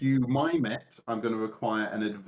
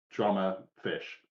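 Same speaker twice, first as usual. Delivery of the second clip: ordinary talk, nothing marked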